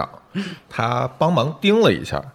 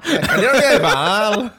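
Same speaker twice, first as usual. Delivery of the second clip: laughing; 11 semitones higher, 5 dB louder than usual. Real, not acted. Laughing jab at the restaurant.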